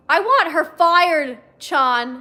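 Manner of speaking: loud, slow furious yell. A narrator's reading. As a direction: yell the demand at him. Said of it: "she yelled at him".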